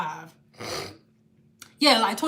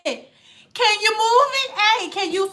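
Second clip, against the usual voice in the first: put-on old lady; 6 semitones higher than usual. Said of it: "you got an old lady like".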